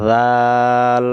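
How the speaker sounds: teacher repeats জাল for the class; model pronunciation. Shown as a demonstration. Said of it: "আবার বলেন".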